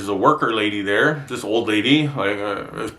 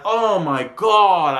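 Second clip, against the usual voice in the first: exasperated; 6 semitones higher, much slower than usual. Retold or imitated. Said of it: "And I went".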